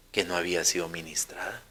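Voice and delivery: soft, low whisper. Real, not acted. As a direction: drop to a hushed whisper for emphasis. Preaching point about the soul.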